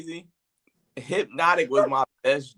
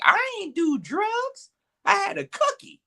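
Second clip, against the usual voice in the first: scratchy and loud. Retold or imitated. Stoned man protesting on video, scratchy and high-pitched. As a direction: imitate your own cooked voice, high and scratchy. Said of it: "My voice got real, like, scratchy and loud".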